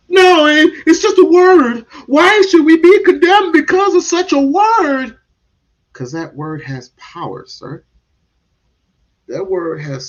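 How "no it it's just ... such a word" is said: high, loud mocking voice of the critics; mocking whiny protester. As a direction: ham up the whining protest, much higher and louder. Mocking opponents' protest, high far voice.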